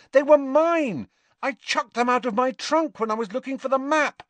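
very high, urgent, exasperated tirade; heated exasperation. A narrator's reading. Harry explains the love potion to Ron, exasperated. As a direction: pile up the facts in high-pitched exasperation.

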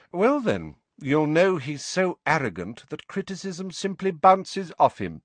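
high-pitched sleek taunt; Snape, sleek. A narrator's reading. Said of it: "Snape said sleekly".

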